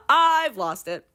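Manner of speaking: high, giggly admission; laughing as if losing it; real laughing. Real, not acted. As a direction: crack up while saying it. Cracks up at own ranting.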